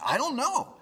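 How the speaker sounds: high-pitched clueless reply; bewildered. Retold or imitated. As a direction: throw the voice way up, baffled.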